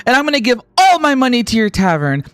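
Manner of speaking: put-on starry-eyed kid. Wolio telling Hogan he'll give all his money, adoring kid voice. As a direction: gush like a little fan.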